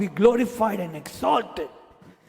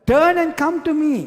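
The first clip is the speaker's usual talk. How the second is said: higher and louder, calling out the divine command; commanding call of the Lord; 7 semitones higher, 4 dB louder than usual. Acted. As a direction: proclaim it like God calling his people back. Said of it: "The Lord says".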